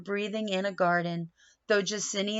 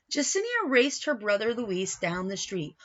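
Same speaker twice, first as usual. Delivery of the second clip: plain reading voice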